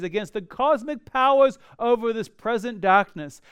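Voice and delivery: fervent pulpit delivery; preaching. Acted. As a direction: preach it out to the congregation. Preaches with force.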